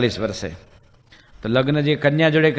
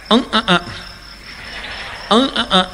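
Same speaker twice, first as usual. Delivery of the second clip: nasal mumble; 8 semitones higher than usual. Retold or imitated. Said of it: "એ આવો હતો ગૂંગણો".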